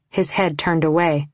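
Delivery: ordinary delivery, nothing marked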